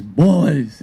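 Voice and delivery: loud call down the dugout bench. Retold or imitated. Pitcher calls his teammates to attention, loud call. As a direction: shout "Boys" to get the team's attention.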